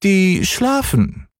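high, slow small-cat voice; little cat calling down. A narrator's reading. Cat answering the boy from the tree, high slow call. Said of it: "rief die kleine Katze herunter".

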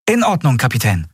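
put-on high, breathless eager boy. Miko to the captain promising anything, eager and high. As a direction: gush gratefully, high.

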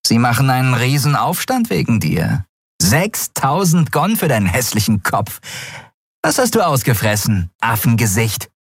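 lightly voiced mocking rogue; gruff thug Grau. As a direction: sneer at him like a street tough. Grau taunts Kai.

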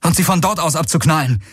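high, urgent called order; rufen. A narrator's reading. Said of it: "rief Galen". (hoher, drängender Zuruf)

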